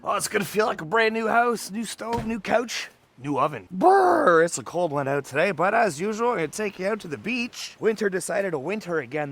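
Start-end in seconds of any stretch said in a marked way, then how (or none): 3.7-4.4 high shivering brr; shivery brr. Real, not acted. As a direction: shiver out loud from the cold. Cold at the beach.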